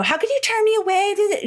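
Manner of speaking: high, whiny mock yell; mock Ellen yelling. Mocking Ellen scolding the husband, high whiny voice. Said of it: "when she yelled at that husband".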